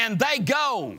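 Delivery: high, loud, driving sermon delivery; full-force preaching. Real, not acted. Preacher challenging church about their words, high full-force preaching. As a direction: raise it to an insistent preacher's shout.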